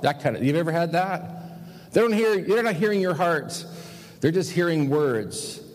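ordinary talk, nothing marked

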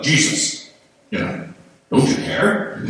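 exasperated, rising appeal; upset. Acted. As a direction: cry out in alarm to someone asleep. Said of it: "I think they're upset".

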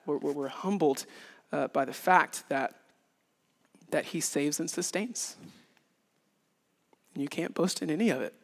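ordinary delivery

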